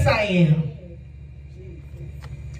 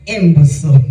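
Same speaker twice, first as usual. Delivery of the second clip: louder, biting on imbecile; spat-out insult; 4 semitones lower, 8 dB louder than usual. Retold or imitated. Preacher spitting the insult at her offender. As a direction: bite the insult out. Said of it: "calling you that".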